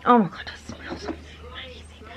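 breathless awed whisper. Real, not acted. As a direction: whisper in awe. Smelling the gift.

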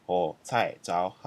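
slow, syllable by syllable; careful slow model phrase; slow demo. Shown as a demonstration. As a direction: spell it out slowly for the learner.

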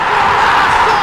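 roaring goal call; goal shout. Real, not acted. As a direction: belt out the goal.

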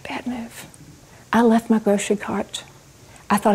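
ordinary talk

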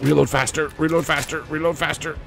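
high, frantic urging; urgent pleading. Real, not acted. Urging the weapon to reload mid-fight.

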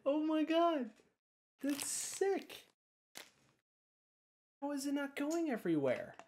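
real stunned wonder; amazed disbelief. Real, not acted. Amazed at second trick.

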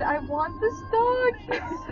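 high, very drawn-out plea; longing gush. Real, not acted. Passenger gushing over the driver's dog, longing whine. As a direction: whine it out with cute-struck longing.

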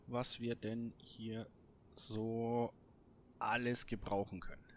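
ordinary delivery

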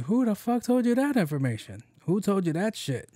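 who told you that, demand it in disbelief; outraged outburst. Real, not acted. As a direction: pitch jumps high, indignant. Outraged at a spam text.

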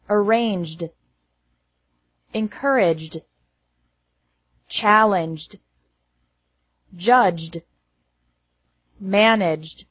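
"robbed", "hugged", "judged" with D joined to the B, G or J sound; careful word-list demo, "managed" closing straight from J to D. Shown as a demonstration. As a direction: end "scrubbed" and "arranged" on D, no extra syllable. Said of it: "E D is pronounced like D".

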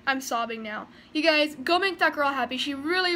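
ordinary delivery, nothing marked

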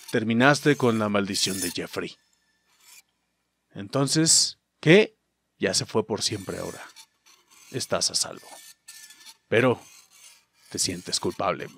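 low, hushed reading with long pauses; solemn ending narration. Acted. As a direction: read out the verdict quietly and gravely. Reads the game's ending text. (lectura grave y en voz baja)

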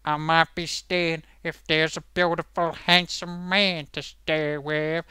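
slow, lightly put-on flirty line; hint of a sultry star; mock Julia Roberts. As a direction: toss the line off slowly like a film star. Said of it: "Julia Roberts goes".

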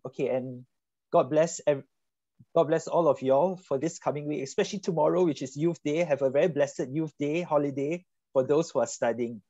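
ordinary talk, nothing marked

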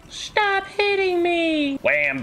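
very high, drawn-out squeal; mock shriek. Player being hit in game, high squealed protest. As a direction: squeal in protest, stretching each word.

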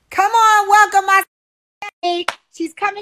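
ordinary talk

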